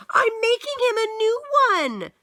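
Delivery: put-on child voice. Child answers mother.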